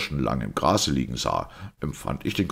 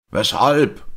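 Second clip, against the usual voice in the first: high, loud, eager question; interested shout; 8 semitones higher, 8 dB louder than usual. A narrator's reading. Listener breaking into the priest's explanation, interested shout. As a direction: call it out, keen to know. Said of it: "schrie O'Brien interessiert".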